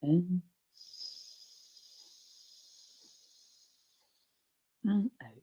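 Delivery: guided breathing cues. Acted. Leads breathing rounds.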